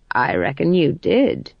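dry no-nonsense crone; stern old witch. Acted. Granny Weatherwax speaks.

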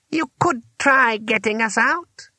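high, slow, pointed reply; testy old wizard. A narrator's reading. Trapped wizard to would-be helper, testy. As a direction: spell it out with pained patience.

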